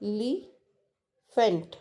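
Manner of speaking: elephant, stretched out very slowly syllable by syllable; slow demo. Shown as a demonstration. Teacher to class, counting syllables slowly. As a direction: draw out elephant so each beat is heard. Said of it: "So that's a".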